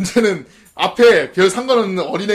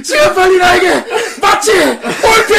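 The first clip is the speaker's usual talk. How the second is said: high, loud, drawn-out mock shout; frantic demand; 12 semitones higher, 6 dB louder, slower than usual. Retelling the patient making a scene, hammed-up shout. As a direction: holler through laughter as a rowdy patient.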